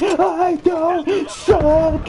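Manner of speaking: high, slow whiny brat yelling; bratty kid shout. Retold or imitated. Bratty kid insisting he's the greatest, high whiny shout. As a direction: shout back like a spoiled kid. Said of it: "He's like".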